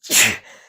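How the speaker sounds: a real loud sneeze; sneezing. Real, not acted. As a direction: let out a big sneeze. Sneeze after announcing it.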